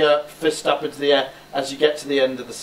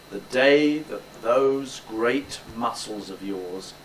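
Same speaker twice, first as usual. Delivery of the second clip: slow, measured delivery on a slightly lowered pitch; 3 semitones lower, slower than usual. Shown as a demonstration. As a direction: start low and stretch it out slowly. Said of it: "start it with a lower pitch".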